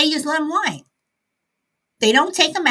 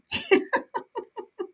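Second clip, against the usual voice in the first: breathless giggling fit; high laugh; 12 semitones higher than usual. Real, not acted. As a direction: burst of high-pitched laughter. Host breaks down laughing.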